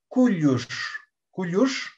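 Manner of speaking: "Cuyush" with firm k and curled-back final sh; tongue back on the end of "Cuyush"; retroflex sh. Shown as a demonstration. Teacher showing K and retroflex, retroflex sh. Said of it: "una retrofleja y también, por supuesto, la, eh, la K".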